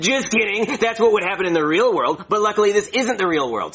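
high, chirpy reversal; gleeful just-kidding. Acted. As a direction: bounce back as if it was all a joke. Gleeful reversal after acting glum.

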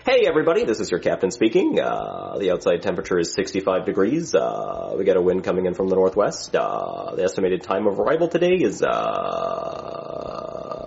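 droning pilot intercom voice with long low uhs; captain announcement. Acted. Captain announces flight details to passengers, intercom drone. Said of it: "I really wish they would let us talk normal into these things".

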